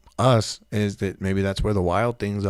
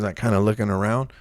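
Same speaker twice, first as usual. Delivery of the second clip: ordinary talk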